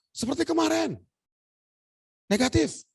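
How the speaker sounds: high, sing-out result announcement; retold nurse's high-pitched call; imitated nurse announcing.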